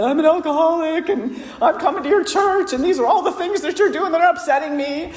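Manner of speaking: high, teary half-laughing rush of words; retold blubbering. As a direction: pour out the list through tears and laughter, reliving it. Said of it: "when I finally got done blubbering".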